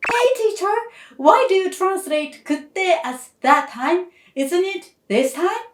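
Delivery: lightly voiced pupil putting up a hand; curious student.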